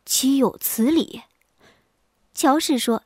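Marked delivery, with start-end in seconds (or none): 0.1-1.2 taken aback, slow. Acted. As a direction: drag it out in mild bafflement. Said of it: "李文平愣了一下说". (愣了一下，慢慢地说)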